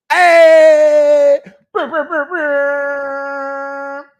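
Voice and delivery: goofy high-pitched brr noise; loud high silly trill. Real, not acted. Joking to chat about Dracula, loud high brr. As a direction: shout hey then a high rolled brr.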